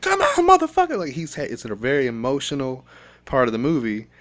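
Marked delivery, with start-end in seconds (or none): 0.0-1.0 high, loud imitated scream; retold scream. Retelling the crying scene from the movie, screaming. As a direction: yell it like he's breaking down. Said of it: "he starts screaming".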